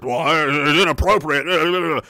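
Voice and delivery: slow, dismissive mock official. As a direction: drag it out like a bored bureaucrat. Mocking the legislature's reply.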